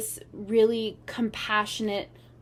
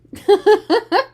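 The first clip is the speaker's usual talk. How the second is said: high, loud laugh that jumps up; real laugh breaking out; burst of laughter; 11 semitones higher, 14 dB louder than usual. Real, not acted. Laughing at Bob while showing him to viewers.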